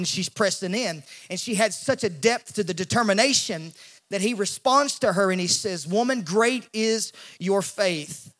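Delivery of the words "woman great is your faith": slow, weighty blessing; Jesus speaking slowly to the woman; slow pronouncement. Retold or imitated.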